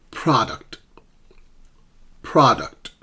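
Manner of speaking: "Product" with a firm PRO, falling away; PRO-duct, second syllable weak; stress on first syllable. Shown as a demonstration. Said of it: "the first syllable pra is stressed".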